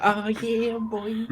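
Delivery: high mock cheer; imitating cheer. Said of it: "You're listening".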